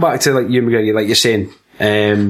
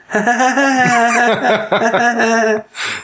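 high, long creepy laugh; imitated Anakin laugh; 9 semitones higher than usual. Mocking Anakin laughing on the speeder, creepy laugh. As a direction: cackle on and on in a high put-on laugh. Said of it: "Anakin laughing on the speeder".

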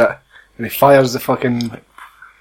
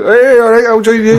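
high, loud mock-eager quote; mock loud cheer; 8 semitones higher, 4 dB louder than usual. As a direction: shout it up high, hammy. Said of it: "Upton's like".

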